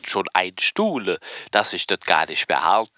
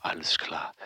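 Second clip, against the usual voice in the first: whispering; 4 semitones lower, 9 dB quieter than usual. Acted. Caller signing off.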